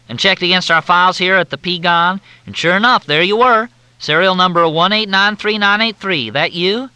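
high, loud, syrupy Southern drawl; Southern officer on the phone, in a thick drawl. Acted. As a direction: drawl it out like a courteous Southern major. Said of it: "The drawl was thicker than Mississippi mud".

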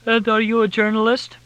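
high, loud question through a yawn; voiced as if mid-yawn. A narrator's reading. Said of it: "she yawned".

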